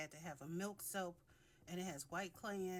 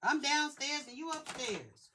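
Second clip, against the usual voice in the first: yelling across the room; 6 semitones higher, 8 dB louder than usual. Real, not acted. Yelling back to someone upstairs.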